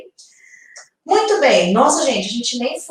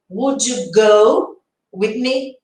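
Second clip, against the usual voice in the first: slow model sentence; much slower than usual. Shown as a demonstration. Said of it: "teacher models English sentence".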